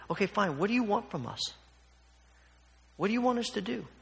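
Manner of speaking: sarcastic. Acted. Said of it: "response is pretty sarcastic".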